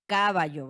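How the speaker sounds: "caballo" with the first syllable CA hit hard; wrongly stressed first syllable. Shown as a demonstration. Teacher showing students how not to say it, stress on CA in "caballo". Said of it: "Yo no pronuncio esta palabra como caballo".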